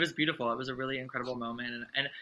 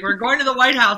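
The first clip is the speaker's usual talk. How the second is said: retold thrilled news, much higher and louder; excited announcement; 12 semitones higher, 15 dB louder than usual. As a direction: blurt the big news, over the moon. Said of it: "I was like".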